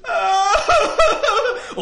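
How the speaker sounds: loud high wail of a grown man crying. Acted. Neighbor bursts into loud tearful wailing. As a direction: wail it out like a big baby.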